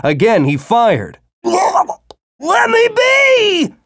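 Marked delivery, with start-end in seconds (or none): 1.4-3.7 very high, very slow pained shout; worm crying in pain. A narrator's reading. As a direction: cry out as a hurt beast. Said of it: "the worm cried out in pain".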